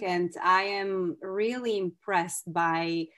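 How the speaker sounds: ordinary talk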